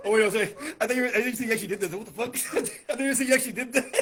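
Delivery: loud, fast disbelief; shocked laughing. Real, not acted. To his guest, shocked and laughing about the raccoon. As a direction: blurt it out half laughing.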